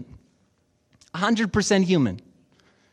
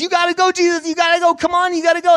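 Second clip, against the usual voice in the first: high, louder frantic urging; mock urgent pleading; 10 semitones higher, 5 dB louder, much faster than usual. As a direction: imitate a crowd pressing him to go. Everyone urging Jesus to hurry.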